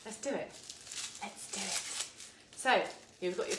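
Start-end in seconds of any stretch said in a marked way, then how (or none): 1.2-2.6 slow breathy whisper, no voice. Real, not acted. Cook whispering her cue to viewers, drawn out. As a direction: hush it slowly, like a secret. Repeats phrase, second whispered.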